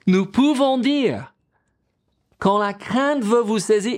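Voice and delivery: ordinary delivery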